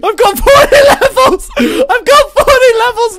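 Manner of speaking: very high, very loud panicked wail; despairing shout. Real, not acted. Losing forty levels after a fall, wailing high and loud. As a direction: scream in loss, half laughing, half crying.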